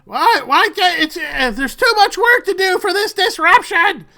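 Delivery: put-on high, loud indignant old man; old Ford shouting at the soldiers; Ford's voice. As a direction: protest the disruption at the top of his voice. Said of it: "the signature voice of Ford call out".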